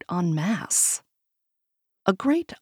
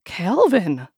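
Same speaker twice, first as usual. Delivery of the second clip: alarmed; 3 semitones higher, 5 dB louder than usual. A narrator's reading. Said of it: "she said in alarm".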